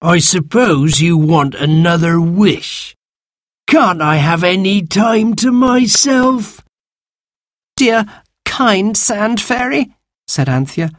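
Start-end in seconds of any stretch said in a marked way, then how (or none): 0.0-6.6 high, slow, loud creature complaint; put-upon magical creature, high-pitched; exasperated sand fairy. A narrator's reading. Sand fairy complains at being disturbed. 7.8-9.9 put-on high, slow coaxing child; butter him up sweetly; wheedling, high-pitched appeal; pleading. A narrator's reading. Girl begs the fairy.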